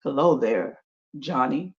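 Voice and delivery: low, soft talking tree; deep tree voice. Acted. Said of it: "the tree says to Johnny".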